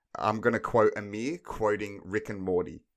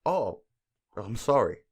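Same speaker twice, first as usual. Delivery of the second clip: mock sorry. Shown as a demonstration. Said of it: "it would probably be like this".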